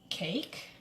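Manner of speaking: ordinary talk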